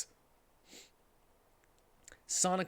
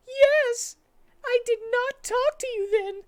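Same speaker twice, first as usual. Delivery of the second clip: put-on squeaky high girl voice, louder; high Amy voice; 8 dB louder than usual. Amy tells Sonic about Shadow, squeaky high voice. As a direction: pitch way up as Amy, shaken.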